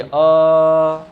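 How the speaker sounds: अ held out as one long, loud, steady vowel. Shown as a demonstration. Teacher shows class a long vowel, अ drawn out and sustained. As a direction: stretch अ for seconds without a break. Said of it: "इसको लंबा बोल के देखिए".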